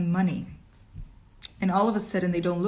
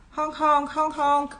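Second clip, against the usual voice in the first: high repeated honking; mad horn cry; 9 semitones higher, 5 dB louder than usual. Acted. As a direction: honk wildly, high and insistent. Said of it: "came the mad cry".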